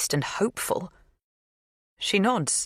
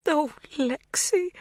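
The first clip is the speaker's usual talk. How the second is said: slow, high, breaking sob-like Oh, Lexi; 12 semitones higher, slower than usual. A narrator's reading. Magda overcome with grief, voice breaking. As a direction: let the voice crack on it. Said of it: "Her voice breaks".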